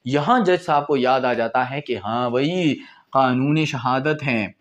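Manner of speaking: ordinary talk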